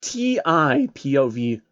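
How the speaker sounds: ordinary delivery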